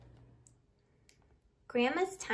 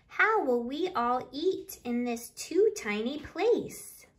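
louder, lively picture-book reading in rhyme; reading to children; 6 dB louder than usual. Acted. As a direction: read the counting rhyme out to the little ones with bounce. Reads picture book aloud.

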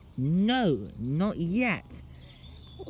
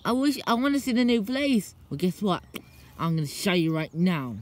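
usual speaking voice

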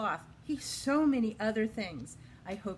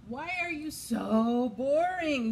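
loud teasing anteater; 4 semitones higher, 5 dB louder than usual. Acted. Anteater asks sloth.

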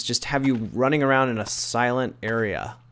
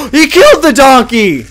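shocked yell; 12 semitones higher, 15 dB louder, slower than usual. Real, not acted. Horror game jumpscare.

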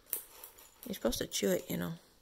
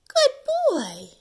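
high, loud squeal of praise; squeaky praising a dog; 13 semitones higher, 10 dB louder than usual. Real, not acted. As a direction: squeal it to the puppy.

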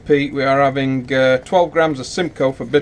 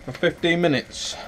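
ordinary talk, nothing marked

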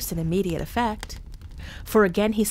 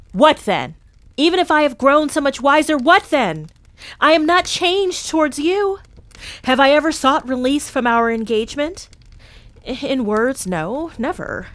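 raised, high, insistent pleading; heated protest; 7 semitones higher, 8 dB louder than usual. A narrator's reading. Scrooge defending himself to his fiancee, heated. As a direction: push back hard, voice lifted.

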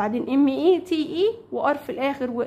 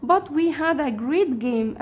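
very slow, clear English for learners; teacher voicing the girl's line, very slowly; slow read-aloud; 2 semitones higher, much slower than usual. Shown as a demonstration. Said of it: "قالت له".

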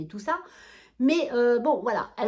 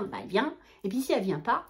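ordinary talk, nothing marked

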